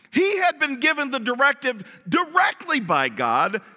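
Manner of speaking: ordinary talk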